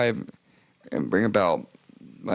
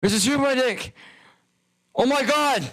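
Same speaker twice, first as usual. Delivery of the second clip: panicked yell. Retold or imitated. Waking from overdose, yelling about the catheter: frantic high yell. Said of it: "the first thing I yell when I wake up".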